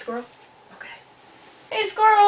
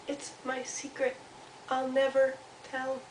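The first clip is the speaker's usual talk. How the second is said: guard the secret gently, word by word; secretive little Mouse; 5 semitones higher, 7 dB quieter than usual. Acted. Mouse answers Squirrel, soft and secretive.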